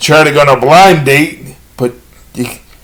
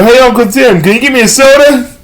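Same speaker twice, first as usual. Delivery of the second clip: lightly acted, higher-pitched request; pestering nephew; 6 semitones higher, faster than usual. Nephew asks uncle at rest stop, mildly pestering. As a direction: pipe up as if pestering a relative.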